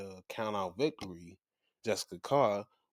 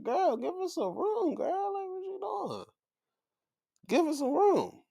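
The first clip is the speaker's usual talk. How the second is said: high, mock-bossy voice much higher than own; mocking Becky. Becky imitated telling the announcer to back off, in a high mock voice. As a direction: push her away with a high sassy mock voice.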